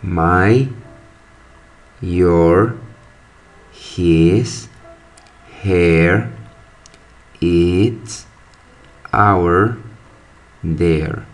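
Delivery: each word said on its own in careful English; teacher reading a list of English words aloud; English pronunciation demo. Shown as a demonstration. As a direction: model every word clearly for the class. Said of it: "La pronunciación sería".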